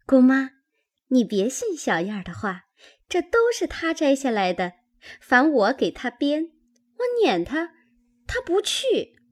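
put-on high, teasing girlish voice; playful teasing. A narrator's reading. Maid jokingly blaming Chunyan to her aunt, playful teasing. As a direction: tell tales playfully in a high voice. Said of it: "婴儿笑道".

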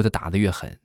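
ordinary delivery

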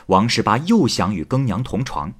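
plain reading voice

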